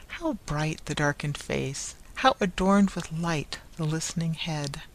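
ordinary delivery, nothing marked

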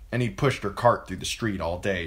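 ordinary delivery, nothing marked

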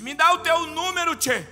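high, very loud bellow; imitated gaucho's demand. Humbled man demanding the preacher's number, bellowed order. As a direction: shout it like an order. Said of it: "ele disse".

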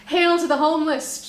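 rally the crowd with it; cheering. Acted. Crowd cheering for the homeless poet, loud and high. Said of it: "cheered the crowd".

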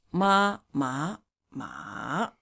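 "ma" in contrasting tones; tone demo. Shown as a demonstration. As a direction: show the tones on ma. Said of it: "ma should go down and up".

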